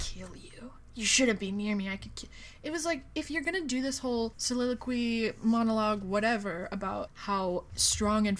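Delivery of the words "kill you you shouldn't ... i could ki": whispered mock menace; hushed, hammed-up threat. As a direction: hiss it like a spooky vampire. Voicing Edward menacing Bella.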